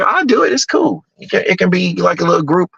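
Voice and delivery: high, bright-pitched imitation of a female friend; lightly voiced woman friend; voicing his friend. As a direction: lift the voice high to play her. Friend Doctor Ball voiced, high.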